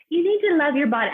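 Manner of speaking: high, loud, scolding hipster voice; preachy hipster. Acted. Hipster correcting a comic after her set, preachy and high. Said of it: "the hipsters come up to me after".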